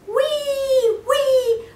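high siren wail; ambulance siren wee. A narrator's reading. Boy playing ambulance, high siren noise. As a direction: whoop up high like a toy siren. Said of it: "making noises with his mouth".

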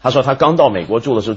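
normal talking voice

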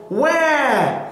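high, drawn-out imitated wail; baby seal cry. A narrator's reading. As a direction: call out "wah" like a baby seal. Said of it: "the baby seal cried back".

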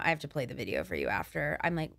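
normal talking voice

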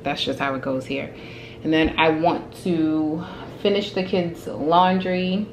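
normal talking voice

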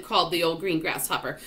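usual speaking voice

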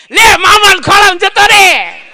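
high, loud laughing woman; woman handing her child to the young man, loud and high; cheeky call. Acted. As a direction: push the child at him cheerfully.

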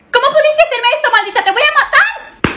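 high, loud screaming rage; furious shout. Acted. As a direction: scream it at her rival. Furious attack.

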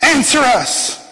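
high, pleading cry to Baal; mock pagan cry. Prophets of Baal crying out to their god, high and pleading. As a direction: cry it up to an idol.

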